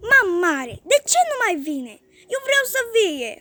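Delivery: put-on high, bossy child's frown of a demand; a spoiled boy giving orders; commanding little boy. A narrator's reading. Spoiled little Goe orders his grandma around, bossy. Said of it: "cu un ton de comandă, zice încruntat".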